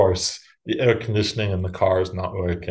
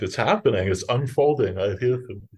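normal talking voice

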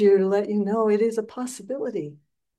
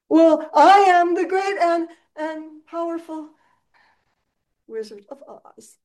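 grand wizard; 9 semitones higher, 5 dB louder than usual. Retold or imitated. Wizard announces himself grandly.

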